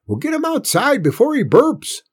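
put-on high, loud old man's yell; urgent yell. A narrator's reading. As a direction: yell the order in a hurry. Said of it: "Grandpa yelled".

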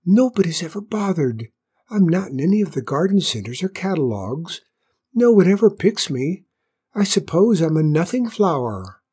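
high, small, forlorn flower voice; timid little flower feeling overlooked; shy whispering flower. A narrator's reading. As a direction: confide shyly in a little high voice. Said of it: "whispered the flower".

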